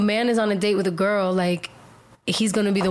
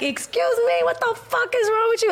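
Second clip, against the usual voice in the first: high-pitched, indignant re-enactment; going off. Retold or imitated. Snapping back at guys who asked to join, high outraged retelling. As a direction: act out going off on someone, voice shooting up. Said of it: "I went off".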